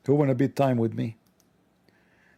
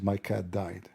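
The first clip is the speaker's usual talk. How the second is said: low, quiet, grave confiding voice; a hint of a gloomy naive man; solemn admin; 5 semitones lower, 8 dB quieter, slower than usual. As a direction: lower the voice like sharing a secret.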